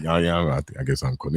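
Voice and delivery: mock sheepish guy. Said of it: "And you like".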